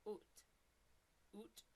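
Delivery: Canadian "Out", vowel pushed toward "oot"; Canadian accent. Shown as a demonstration. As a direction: try "Out" as a Canadian would. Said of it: "it's kinda like more out".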